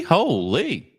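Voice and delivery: ordinary talk, nothing marked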